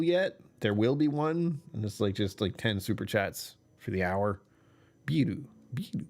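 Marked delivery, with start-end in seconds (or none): 5.1-6.0 "Biru, biru" said the Japanese way, two clear syllables "bi-ru"; ビールと日本語風に. Shown as a demonstration. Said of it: "something like that".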